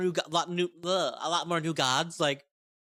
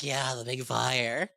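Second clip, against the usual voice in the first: slow, lower, dazed goblin yes; dopey agreement; 4 semitones lower, much slower than usual. Retold or imitated. Goblin crowd agreeing to stare at the sun, slow and dopey. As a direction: drag it out like a dim goblin in awe.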